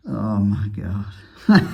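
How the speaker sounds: slow, loud exasperated oh my God; exasperated groan. Real, not acted. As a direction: drag it out in despair. Sees more leaking parts.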